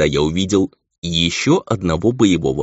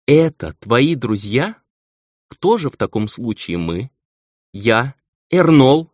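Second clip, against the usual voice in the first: raised, drawn-out disbelieving questions; higher, affronted surprise; incredulous questioning; 7 semitones higher, 5 dB louder, slower than usual. A narrator's reading. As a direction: challenge him in astonishment. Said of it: "Архел удивлённо вскинула".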